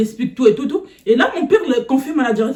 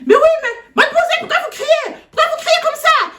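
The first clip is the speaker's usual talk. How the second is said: very high mock principal voice; exaggerated high-pitched principal; imitating principal. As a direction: ask why she is yelling, hammed up high. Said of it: "la directrice, elle vient".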